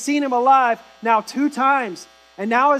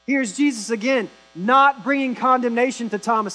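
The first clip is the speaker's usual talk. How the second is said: ordinary talk, nothing marked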